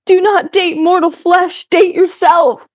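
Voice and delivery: high, slow mock-grand pronouncement; mock solemn decree. Streamer reading Michael's chat advice aloud as a grand decree. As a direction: deliver it like a lofty oracle. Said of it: "Michael said".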